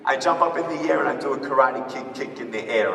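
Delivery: ordinary talk, nothing marked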